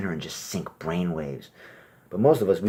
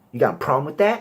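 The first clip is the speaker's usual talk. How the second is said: tough challenge; 8 semitones higher, 6 dB louder, faster than usual. Retold or imitated.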